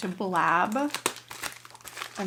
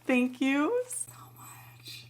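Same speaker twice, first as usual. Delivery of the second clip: high, choked-up gratitude; overwhelmed, voice rising near tears; getting emotional; 5 semitones higher, much slower than usual. Real, not acted. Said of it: "I'm getting, like, emotional".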